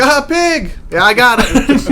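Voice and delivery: high, lightly voiced broken-English reply; imitating the brother. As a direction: mimic a man with little English, high up.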